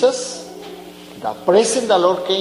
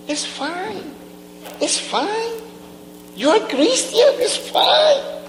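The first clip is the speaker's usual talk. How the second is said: much higher, thin teasing voice of the tempter; mocking devil voice; 4 dB quieter than usual. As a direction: sneer it like the devil whispering excuses.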